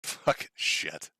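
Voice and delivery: whispered curse under breath; exasperated whisper. Real, not acted. Alone after the rant, whispering an exasperated curse. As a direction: mutter it, defeated.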